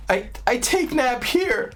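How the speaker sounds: put-on high, slow, babyish toddler voice; upset little girl. Upset toddler at the beach refusing to move, high slow whine. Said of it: "and she's like".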